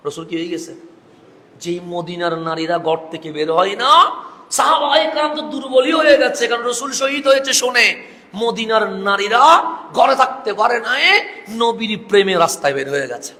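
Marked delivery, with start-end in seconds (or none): 1.6-13.3 raised, loud sermon narration; impassioned preaching. A narrator's reading. Preacher tells of Medina's women pouring into the streets, impassioned preaching. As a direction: climb high and loud, then settle at the end.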